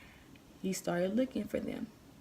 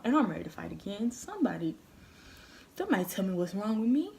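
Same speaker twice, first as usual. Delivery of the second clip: ordinary delivery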